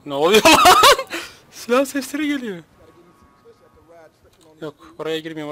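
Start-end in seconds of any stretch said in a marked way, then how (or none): 0.6-1.3 loud, high burst of laughter; laughing. Real, not acted. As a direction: explode into laughter. Şaşırıp gülüyor. (yüksek sesli tiz kahkaha)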